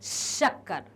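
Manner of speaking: "शक्कर" low, opening on long sh; long palatal sh. Shown as a demonstration. Teacher repeating the example, "शक्कर" with held sh. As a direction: lean on the hush starting "शक्कर". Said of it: "ऐसे करते हैं श".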